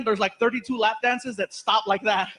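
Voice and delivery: usual speaking voice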